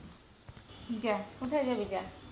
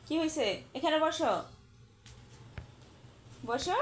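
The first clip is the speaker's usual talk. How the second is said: higher, sweet pet talk; coaxing pet; 4 semitones higher than usual. Real, not acted. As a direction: coax the animal gently.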